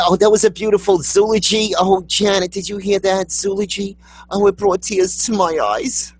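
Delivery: high-pitched gushing churchgoer after a funeral; mock-moved funeral guest, pitched high; gushing funeral mourner. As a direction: gush tearfully over a eulogy, hammed up. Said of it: "sounds like the thing that they say".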